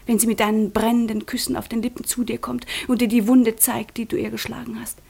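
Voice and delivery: normal talking voice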